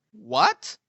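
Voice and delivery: "What?" rising sharply high, like a confused question; "What?" as a puzzled rising second tone. Shown as a demonstration. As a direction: ask "What?" with a steep upward glide. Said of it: "you're gonna ask him".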